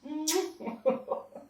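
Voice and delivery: short real laugh; a burst of laughter at her own joke; brief amused laugh. Real, not acted. Laughs after teasing the class.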